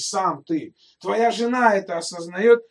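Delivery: ordinary delivery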